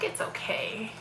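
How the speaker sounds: hushed, soft reassurance; retold comforting. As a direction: soothe quietly, almost whispering. Retold nurse comforting crying patient.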